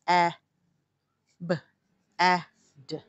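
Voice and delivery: ordinary talk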